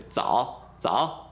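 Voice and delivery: "Zao" said low, dipping in third tone; teacher modelling a low "zao"; word demo. Shown as a demonstration. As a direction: drop "zao" low, one word alone. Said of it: "just say this by itself".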